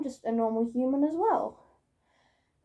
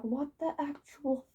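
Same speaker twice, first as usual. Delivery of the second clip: mock-baffled quote of oneself; acted bafflement; 2 semitones higher, 4 dB quieter than usual. Vlogger re-enacting their reaction to furries, mock-baffled. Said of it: "and me being like".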